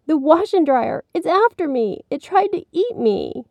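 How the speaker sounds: put-on high, alarmed child's voice; frightened little boy. A narrator's reading. Billy explaining to mommy, frightened. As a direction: insist it's coming for you.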